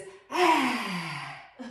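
breathy roaring exhale with tongue out; lion's breath roar. Shown as a demonstration. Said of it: "do the lion breath and do it like this".

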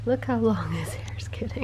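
hushed whisper so as not to wake him. Real, not acted. Whispering beside sleeping baby.

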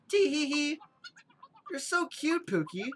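high, cutesy sweetheart voice; lovey-dovey couple. Acted. Sweethearts flattering each other, high and mock-cutesy. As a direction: coo like a sickly-sweet lover. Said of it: "Lovey-dovey couple".

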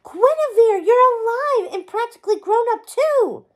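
high, loud delighted exclamation; overjoyed. A narrator's reading. Merlin greeting the long-lost Guinevere, overjoyed. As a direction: burst out with a huge grin in the voice. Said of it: "broke out into a huge grin".

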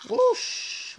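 a whoosh sound whose voice cracks up high; voice-cracking whoosh. Real, not acted. Making shark swim noises, the voice cracks high. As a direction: blow out a whoosh and let the voice crack upward. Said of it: "My voice just cracked there".

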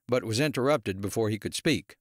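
usual speaking voice

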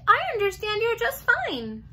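high, urgent hamster squeak; frantic squeak. A narrator's reading. Hamster reassuring the girl, frantically squeaking. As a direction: squeak it out insistently. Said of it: "I frantically squeaked".

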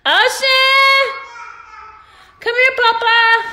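high, loud call across the room. Real, not acted. Mother calling her son back across the room, loud and high.

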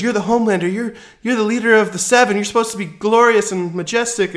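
high, loud panicked disbelief; shrill cry of a shattered fan; freaking out. Retold or imitated. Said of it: "She's freaking out".

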